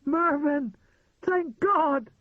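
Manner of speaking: high, loud burst of relief; relieved cry. A narrator's reading. Trapped woman hears her rescuer.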